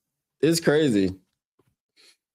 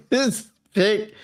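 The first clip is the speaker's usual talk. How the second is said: higher, laughing through it; 5 semitones higher than usual. Real, not acted. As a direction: laugh as you say it. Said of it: "This pick doesn't make sense".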